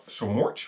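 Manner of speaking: "much" slipping out as "morch"; "much" mangled with an or vowel; garbled pronunciation. Real, not acted. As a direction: stumble on "much", putting an r sound in it. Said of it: "English is failing me".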